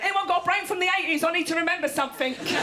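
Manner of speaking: high, fast, frantic asking; mock urgent plea. Person running into rooms seeking a brain, urgent. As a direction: rush it out like someone desperate. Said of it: "people running into rooms going".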